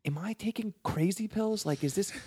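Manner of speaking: high, incredulous inner voice; as if you're losing your mind; inner disbelief. Retold or imitated. Thinking about a coworker's refusal, incredulous. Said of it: "in my head going".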